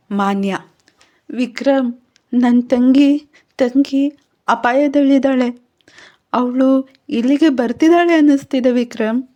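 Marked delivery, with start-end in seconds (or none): 1.3-9.2 higher, louder frantic words; stumbling over her sister in fear; frightened. Acted. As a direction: Manya telling Vikram her sister is in danger, panicked. Manya fears for sister.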